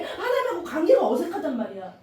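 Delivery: normal talking voice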